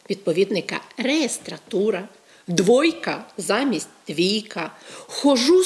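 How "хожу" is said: хожу said the Russian way, loud; the wrong form хожу set against ходжу; Russian-style form. Shown as a demonstration. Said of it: "замість наших нормативних ходжу".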